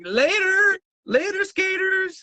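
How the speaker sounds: chirpy sendoff, drawn out; mock goodbye. Town mocking Walmart's closing with a high singsong goodbye. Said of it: "They were like".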